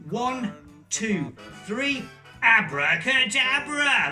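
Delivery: big slow magic-word countdown; kids party magician. Acted. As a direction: call out the spell grandly. Magic word for the top hat.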